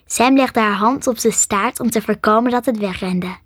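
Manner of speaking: ordinary delivery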